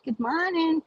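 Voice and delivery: high, put-on pleasant good morning; retold chirpy greeting; polite greeting. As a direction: greet her sweetly, holding back the retort. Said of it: "I just go".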